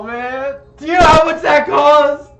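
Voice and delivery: high, very loud wailing shout; bawling. Acted. Zebediah mourns his costly doll, bawling loudly. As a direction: bawl it out, hammed-up and distraught. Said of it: "and he's just bawling".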